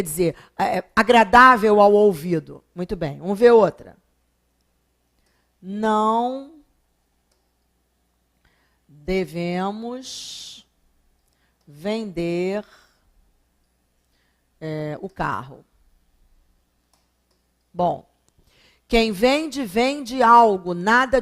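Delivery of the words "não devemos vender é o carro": very slow, word by word, with long pauses; slow dictation. Shown as a demonstration. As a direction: dictate it slowly so the class can copy. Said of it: "teacher reads the next example". (frase ditada bem devagar, palavra por palavra)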